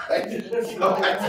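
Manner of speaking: words breaking up into loud, high laughing; helpless laugh while trying to talk; real laughter. Real, not acted. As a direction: crack up mid-sentence. Cracks up telling the story.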